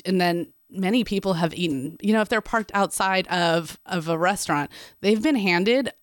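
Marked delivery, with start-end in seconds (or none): none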